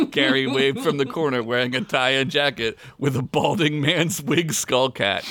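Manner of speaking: usual speaking voice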